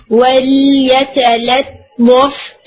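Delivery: ولیتلطف very slow, both ل light and spread; slow recitation, light lams. Shown as a demonstration. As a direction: recite ولیتلطف slowly with two thin lams. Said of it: "اس میں دونوں لام". (ولیتلطف آہستہ، دونوں لام باریک)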